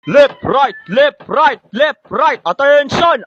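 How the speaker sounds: barked marching cadence; drill sergeant. Acted.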